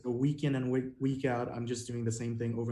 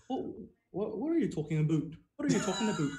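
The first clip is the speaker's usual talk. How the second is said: higher-pitched mock Canadian accent; 7 semitones higher, faster than usual. Shown as a demonstration. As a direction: put on a Canadian accent, "about" as "aboot". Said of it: "this is a stereotypical Canadian".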